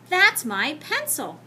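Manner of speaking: put-on a boy pleading his case slowly; trying to explain. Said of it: "John tried to explain".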